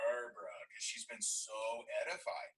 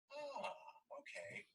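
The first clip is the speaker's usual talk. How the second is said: very high, faint surprise; mock muted surprise; soft surprised oh; 8 dB quieter, much slower than usual. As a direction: react quietly surprised. His reaction to her admission.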